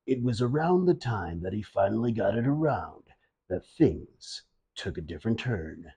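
low, soft ominous documentary narrator; mock narrator voice. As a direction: intone it like a grim storyteller. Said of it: "Narrator's voice creeps back in".

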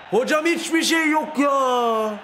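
high, drawn-out cry of protest; shouted protest. Real, not acted. Protests a call to referee. (hakeme uzatarak bağırılan itiraz)

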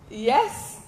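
ordinary delivery

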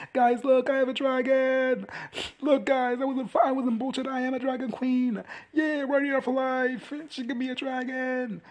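high, drawn-out bragging shout; hammed-up boast called out to everyone below; excited showing off. Voicing a boast from dragonback.

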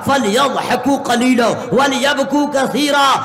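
slow melodic recitation of the verse; Quranic recitation. Acted. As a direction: chant the Arabic slowly.

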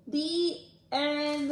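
lively storybook read-aloud; animated picture book reading; reading to children. Acted.

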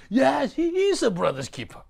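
high, loud preaching retort; forceful rebuttal. Real, not acted. Preacher rebuts Cain before the congregation, loud and high. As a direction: slam the answer down.

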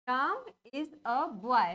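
word by word, long drawn out; spaced-out, deliberate example sentence; slow dictation. Shown as a demonstration. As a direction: read it slowly as if writing it up. Reads out example while writing it.

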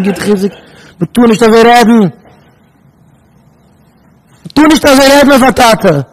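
high, forceful admonishing voice; indignant preacher's outburst; impassioned rebuke. Real, not acted. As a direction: raise your voice in protest, building to a loud cry. Impassioned rebuke about talking of father.

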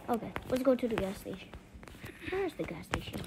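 ordinary talk, nothing marked

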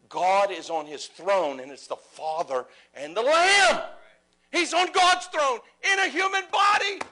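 preacher bellowing the climax to his congregation; preaching shout. Real, not acted. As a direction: roar it out to the whole church. Preacher's climax about God's throne.

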